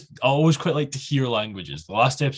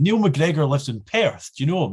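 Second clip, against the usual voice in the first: mock eager question; 5 semitones higher, 4 dB louder than usual. Retold stranger keenly asks about his pal.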